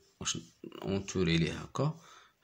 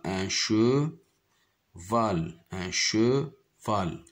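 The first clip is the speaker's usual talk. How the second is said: slow model word; much slower than usual. Shown as a demonstration. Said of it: "teacher models the word slowly".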